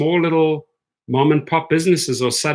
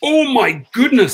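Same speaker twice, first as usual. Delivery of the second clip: delighted gasp; 8 semitones higher, 5 dB louder than usual. Real, not acted. Sees food brought to him.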